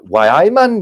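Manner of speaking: loud, high Geordie exclamation; Geordie accent. Shown as a demonstration. Guest giving an example of Newcastle speech, in a Geordie accent. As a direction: sing out a Newcastle Geordie catchphrase. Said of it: "you've also got Geordie".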